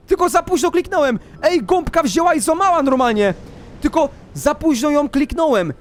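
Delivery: high agitated exclaiming; worked-up explanation. Real, not acted. Player realises the late click, high agitated excitement. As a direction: keep the pitch high while explaining.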